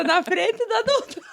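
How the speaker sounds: high, giggly embarrassment; laughing protest. Real, not acted. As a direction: squeal it through laughter. Mock embarrassment amid group laughter.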